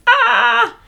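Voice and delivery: short high squeal of anticipation; excited squeal. Real, not acted. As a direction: let out a high little "ah". Nervous excitement opening her results.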